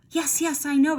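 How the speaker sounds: high, eager burst; excited exclamation. A narrator's reading. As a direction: blurt it out with sudden excitement. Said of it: "exclaimed Manuelo".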